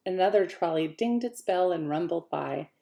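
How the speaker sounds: ordinary delivery, nothing marked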